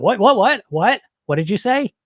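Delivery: brash coach confronting the inner voice. Retold or imitated. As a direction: pounce on the doubt. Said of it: "the coach voice comes out".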